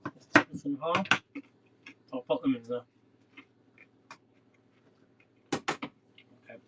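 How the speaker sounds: talking with a mouthful of carrot; words round a bite of carrot; mouth full. Real, not acted. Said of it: "I've just got them carrot in my mouth".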